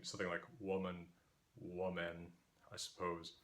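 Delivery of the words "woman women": "woman" singular then plural, both starting WUH; reconstructed older pronunciation. Shown as a demonstration. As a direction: keep the same first vowel in both "woman, woman". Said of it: "these two vowels must have been pronounced the same".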